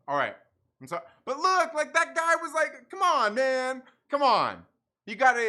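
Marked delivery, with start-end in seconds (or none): 1.2-5.5 loud protest. Real, not acted. Heated defense of the guy.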